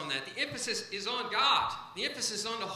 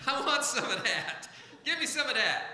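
eager, laughing and a little louder; 4 semitones higher, 4 dB louder than usual. Shown as a demonstration. Preacher to congregation, playfully demanding wisdom, laughing. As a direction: chuckle through the words, asking for more. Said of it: "Look at your neighbor and say".

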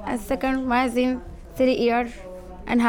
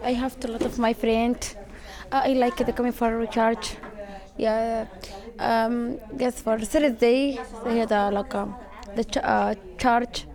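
Sudanese accent. Real, not acted.

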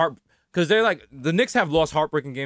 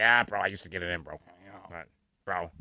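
put-on low, soft, quick old-man voice; hushed, low caricature of an elderly man; imitating old man; 11 semitones lower, 9 dB quieter than usual. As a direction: drop down and mumble as an old grandfather. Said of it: "He's like".

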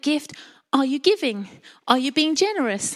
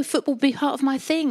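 usual speaking voice

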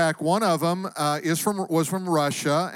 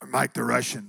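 put-on low Russian-accented voice; Mike the Russian; 4 semitones lower than usual. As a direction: imitate a Russian man, pitched down. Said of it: "he always talks like this".

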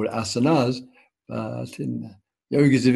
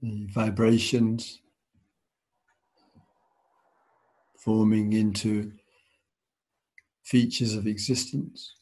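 guided meditation. Acted. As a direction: ease them into stillness, unhurried.

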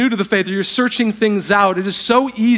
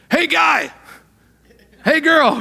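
casual generic greeting; 8 semitones higher, 3 dB louder, slower than usual. Retold or imitated. God greeting people generically.

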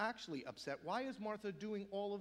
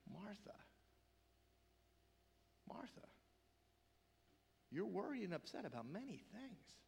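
tender, emotional; 7 dB quieter than usual. Retold or imitated. Jesus to Martha, with love and emotion, hushed and tender. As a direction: say it very softly, with long pauses between the names. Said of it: "you have to understand the emotion in these words".

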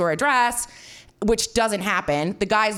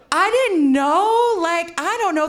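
drawn-out high laughing whine, much higher; sheepish high whine; 7 semitones higher, 3 dB louder, slower than usual. Retold or imitated. Sheepish traveller admitting to the couple she knew nothing, high laughing whine. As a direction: plead ignorance with a laugh, slowly, high and a bit louder.

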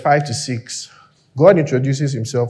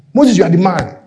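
hyping him up; 8 semitones higher, 7 dB louder than usual. Acted. God hyping up Moses.

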